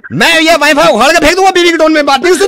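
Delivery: high, insistent mock tirade; hammed-up outrage. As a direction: rant as if pushed past all patience. Prankster's fake rant at wife's tone.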